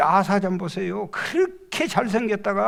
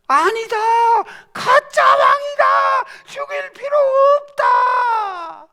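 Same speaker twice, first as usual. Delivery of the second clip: very high, loud battle cry; soldiers shouting; 9 dB louder, slower than usual. Acted. Enemy soldiers cry out in story.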